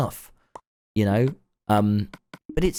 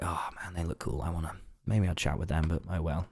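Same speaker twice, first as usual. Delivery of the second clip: much softer, mumbled to himself; quiet self-talk, hesitant then resigned; muttered inner thought; 3 semitones lower, 9 dB quieter than usual. Retold or imitated. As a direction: say it under your breath, giving up. Inner thought about a cool group.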